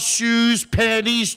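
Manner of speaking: ordinary talk, nothing marked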